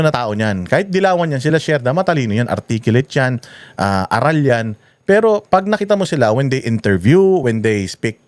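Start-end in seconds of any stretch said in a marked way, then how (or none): none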